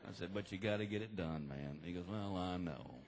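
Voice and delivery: low, quiet, awkward father and son; subdued exchange. Retold or imitated. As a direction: speak low and sheepish after an embarrassing moment. Subdued father-son talk after exam.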